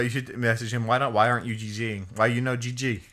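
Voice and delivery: ordinary talk, nothing marked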